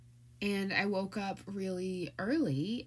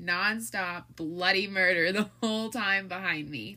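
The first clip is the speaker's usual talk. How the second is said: normal talking voice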